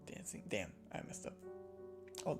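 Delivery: plain reading voice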